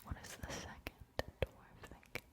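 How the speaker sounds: barely-there whisper, very soft; hushed, almost inaudible whisper; whispered echo. Acted. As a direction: echo the question in a faint whisper. Question echoed as a faint whisper.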